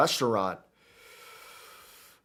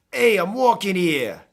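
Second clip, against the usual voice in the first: loud, drawn-out New York accent; higher, brash New York accent as a jump-off phrase; exaggerated Unova New Yorker accent, slow and loud; 7 semitones higher, 7 dB louder than usual. Shown as a demonstration. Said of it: "when I do my Unova accent".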